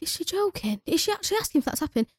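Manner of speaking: retold baffled question under the breath; incredulous aside. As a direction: ask it high and hushed, not believing it. Retold disbelief aside to colleague.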